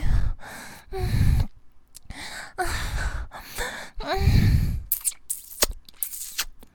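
loud breathy sighs and gulps; vampire feeding sounds. Acted. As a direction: sigh hungrily while drinking blood. Vampire gives in and bites.

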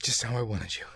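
ordinary delivery

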